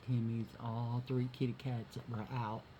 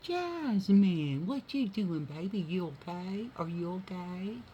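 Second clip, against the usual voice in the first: higher, cooing voice for the cat; 6 semitones higher, 5 dB louder than usual. Real, not acted. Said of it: "I said".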